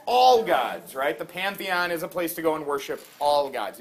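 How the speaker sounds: ordinary talk, nothing marked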